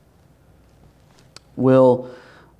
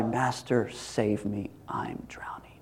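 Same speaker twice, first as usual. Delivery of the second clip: hushed plea fading into a whisper; honest cry; 4 semitones lower, 15 dB quieter, slower than usual. Acted. Drowning believer crying out to the Master, hushed honest cry. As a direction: whisper it as a helpless prayer. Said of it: "the honest cry".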